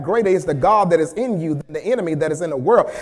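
ordinary talk

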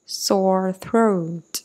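ordinary delivery

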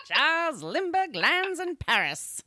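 lightly voiced old newsreel announcer; a hint of a vintage radio host; old-timey voice. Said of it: "I love your old-timey voice".

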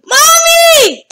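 very high, loud, stretched call; wailing scream. Real, not acted. Gamer calling for mom after losing, wailing scream. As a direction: scream for mom like a sore loser.

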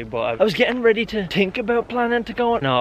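ordinary talk

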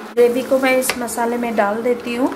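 ordinary delivery

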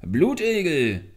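loud, high, drawn-out call; long-distance holler. A narrator's reading. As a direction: shout for someone across the hills. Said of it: "rief er".

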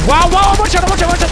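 high, loud amazed yell; amazed shout. Real, not acted. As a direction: shout in disbelief at the shot. Amazed at a one-shot.

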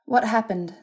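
high, urgent question; demanding. A narrator's reading. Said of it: "she demanded".

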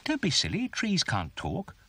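high, chirpy bird voice; twittering bird. A narrator's reading. Birdie doubts the talking tree, twittering. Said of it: "twittered Birdie".

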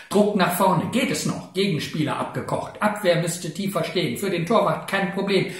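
brisk, punchy play-by-play in short bursts; live football commentary. Acted. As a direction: rattle off the match like a live ticker. Football live-ticker poem performed as commentary. (atemlose Fußball-Live-Reportage)

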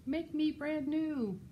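high, drawn-out melted snowman voice; high, slow character call; voice from the hot tub. A narrator's reading. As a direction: stretch out a high little plea. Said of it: "A voice from the hot tub said".

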